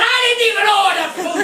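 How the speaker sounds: fast, high and loud gotcha; spring the trap on him; gleeful shout of victory; triumphant gotcha. Retold or imitated.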